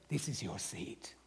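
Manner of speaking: hushed, secret whisper to a big giver; mock whisper. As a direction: lean in and whisper like a privileged usher. Mock usher whispering to donor.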